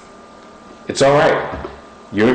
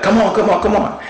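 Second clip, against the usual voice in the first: mock crowd urging; 10 semitones higher, faster than usual. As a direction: jeer like a frustrated home crowd. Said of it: "they're like".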